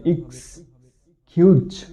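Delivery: "এক্সকিউজ" slowly split into iks, pause, kyooch; syllable-by-syllable pronunciation. Shown as a demonstration. Teacher showing the correct pronunciation, slow syllable-by-syllable demonstration. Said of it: "সঠিক উচ্চারণ হবে".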